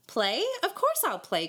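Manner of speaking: high, eager agreement; light up with joy at being asked; joyous cry. A narrator's reading. David agreeing to fiddle for the boys, joyously. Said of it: "cried David joyously".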